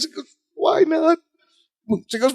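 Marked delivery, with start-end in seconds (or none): 0.6-1.2 high-pitched mock tearful question; holding back tears. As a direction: imitate someone fighting off tears, high and small. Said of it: "Balky's holding back tears".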